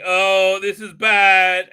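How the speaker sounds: loud, slow mock alarm; mock alarmed queen. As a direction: ham up her worry, drawn out. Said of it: "and she's like".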